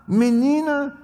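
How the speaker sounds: ordinary talk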